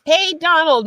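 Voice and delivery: high, loud happy hello to her brother; excited greeting. Real, not acted. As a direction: call out delighted as he arrives. Greeting her brother who joined.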